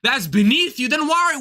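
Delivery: mock disbelief climbing in pitch; incredulous echo. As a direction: throw the phrase back as a challenge. Throws his words back incredulously.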